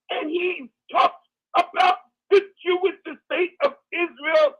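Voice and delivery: rising preacher cadence, building; fired-up preacher delivery; preaching. Acted. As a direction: preach it to the congregation. Preaching at full force.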